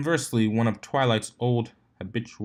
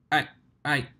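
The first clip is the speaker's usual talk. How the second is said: stammering; 5 semitones higher than usual. Acted. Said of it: "the victim stammered".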